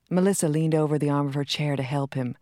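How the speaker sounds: normal talking voice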